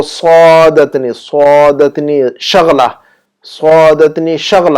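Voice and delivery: slow سعادتنی شغلہ with a drawn-out heavy first syllable; pronunciation model. Shown as a demonstration. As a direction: stretch سعادتنی, then land firmly on شغلہ. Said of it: "یہاں پر شغلہ کہیں گے".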